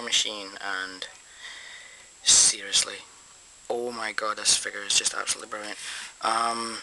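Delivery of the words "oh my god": usual speaking voice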